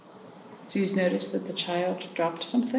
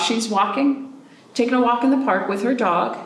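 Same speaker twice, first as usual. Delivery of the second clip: put-on reading to children; 4 semitones higher, 6 dB louder than usual. A narrator's reading. Reading a picture book aloud to kids, gentle storybook voice. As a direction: read it to little ones.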